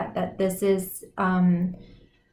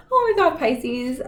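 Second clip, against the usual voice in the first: excited exclaim; 5 semitones higher than usual. Real, not acted. Laughing at the intense cards.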